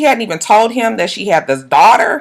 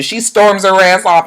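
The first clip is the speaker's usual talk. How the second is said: ordinary delivery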